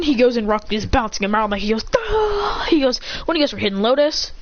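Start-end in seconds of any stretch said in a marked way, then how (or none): 2.0-2.6 high-pitched mock yelp; cry of pain. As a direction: yelp as if knocked about. Acts a fighter being bounced around.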